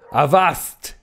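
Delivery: high, sudden cry of command; cried out. A narrator's reading. Stranger halting two sailors from behind, crying out. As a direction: call out sharply to halt them. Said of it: "cried a voice".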